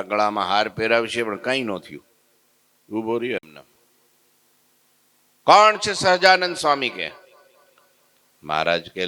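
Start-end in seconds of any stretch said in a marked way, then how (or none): 5.5-6.9 loud, high challenge; arrogant shout. Acted. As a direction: demand it of the whole assembly. Sadhu repeats challenge. (ઘમંડી બૂમ)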